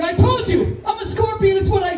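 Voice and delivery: high, matter-of-fact shrug; smug scorpion. Acted. Scorpion tells sinking frog, high smug shrug.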